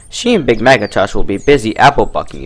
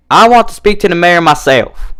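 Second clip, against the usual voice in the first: higher, louder insistence; firm demand; 8 semitones higher, 4 dB louder than usual. A narrator's reading. Applejack demands the mayor, firm and loud. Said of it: "Applejack stated".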